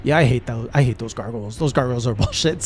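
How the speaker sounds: low, grumbling voice of the chat; chat grumbling agreement. Retold or imitated. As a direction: grumble along like a fed-up crowd. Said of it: "They're all like".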